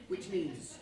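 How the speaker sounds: ordinary talk, nothing marked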